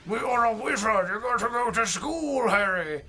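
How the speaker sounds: lightly put-on British Hagrid voice; a hint of gruff Hagrid telling Harry off; mock Hagrid. Hagrid scolding Harry in a mock British voice. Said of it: "Where did you learn this accent?".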